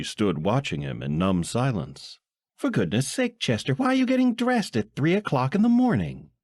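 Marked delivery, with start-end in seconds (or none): 2.6-6.3 high, fast, shrill-pitched wife voice; fretting wife. Acted. Wife scolding husband dressing at three a.m., high voice. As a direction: fuss at him in a high housewife voice.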